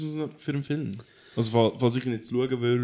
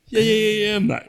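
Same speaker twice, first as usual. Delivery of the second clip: high, loud playful yes, yes; excited; 9 semitones higher, 7 dB louder than usual. Real, not acted. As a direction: toss it back, giddy and loud. Laughing nein banter, loud.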